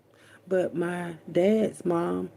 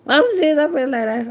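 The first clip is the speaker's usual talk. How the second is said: emphatic; 9 semitones higher, 7 dB louder, faster than usual. Real, not acted. Loud emphatic insist.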